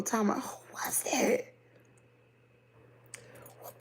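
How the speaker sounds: whispered while trying to remember; unvoiced puzzled musing. Real, not acted. Trying to recall a preacher.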